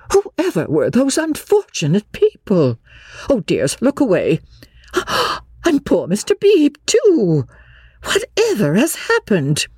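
high, fluttery scandalised lady; shocked exclaiming. A narrator's reading. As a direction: exclaim high in dismay, with a gasp. Honeychurch shocked at the naked men.

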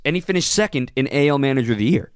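ordinary delivery

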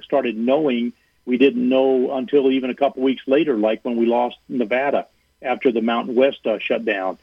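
ordinary delivery

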